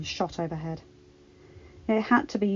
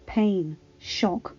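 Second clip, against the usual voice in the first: plain reading voice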